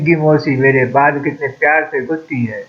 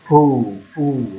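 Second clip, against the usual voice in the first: blowing on wound; much slower than usual. Acted. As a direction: blow gently on the wound. Blows on wound.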